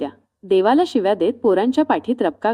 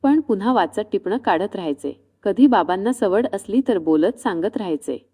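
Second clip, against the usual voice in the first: usual speaking voice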